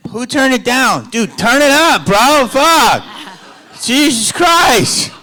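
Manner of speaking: high-pitched, loud yelling; hammed-up shouted tirade; mock outrage. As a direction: blow up at the sound crew for laughs. Mock outburst at the sound guy.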